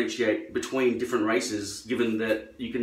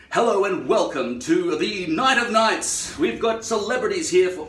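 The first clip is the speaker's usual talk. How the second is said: higher, louder, slow posh Australian announcer; fake clear announcer; 8 semitones higher, 5 dB louder than usual. Host welcoming guests to the Night of Nights, slow overdone announcer voice. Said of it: "When Australians try to be that clear".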